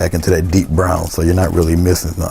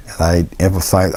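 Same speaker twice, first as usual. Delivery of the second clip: normal talking voice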